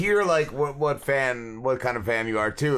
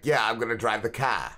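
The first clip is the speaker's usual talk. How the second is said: lower-voiced Maine grandfather, New England accent; thick New England accent; 4 semitones lower than usual. Imitating his grandfather from Maine, thick New England accent. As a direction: drop the r like an old-school New Englander. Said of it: "that thick New England accent".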